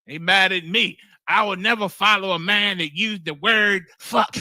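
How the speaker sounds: ordinary talk, nothing marked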